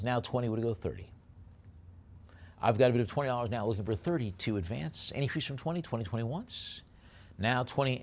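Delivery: fast, punchy auctioneer patter; auction bid calling. Acted. Auctioneer to online bidders, brisk bid calling. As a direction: rattle off the bids and knock it down.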